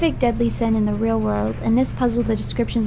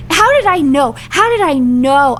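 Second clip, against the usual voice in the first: loud, exasperated outburst; loud, worked-up frustration; angry exclamation; 2 semitones higher, 6 dB louder than usual. Real, not acted. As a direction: cry out in frustrated disbelief. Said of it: "I am so angry".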